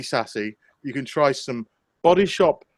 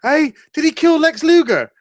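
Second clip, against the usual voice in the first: baffled viewers; 6 semitones higher than usual. Retold or imitated. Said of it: "people going".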